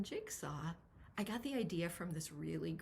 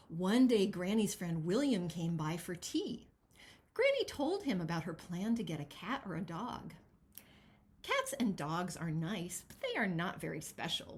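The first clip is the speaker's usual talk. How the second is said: lively picture-book read-aloud; expressive storybook narration; reading to children. Acted. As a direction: read it as if to a room of kids.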